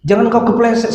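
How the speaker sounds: ordinary talk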